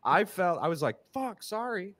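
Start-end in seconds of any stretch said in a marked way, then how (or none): 1.1-2.0 embarrassed apology. Retold or imitated. Embarrassed apology acted in story.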